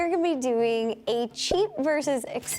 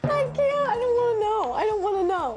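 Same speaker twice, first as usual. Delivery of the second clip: squealing; 9 semitones higher, faster than usual. Real, not acted. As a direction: squeal it out in giddy dread. Dreading the price reveal.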